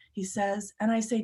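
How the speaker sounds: usual speaking voice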